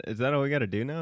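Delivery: usual speaking voice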